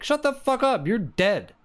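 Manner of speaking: high, loud hammed-up retort; mock yell at screen. Host yelling at Lily on the screen, high mock shout. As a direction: snap back at her like a fan yelling. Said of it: "And I'm like".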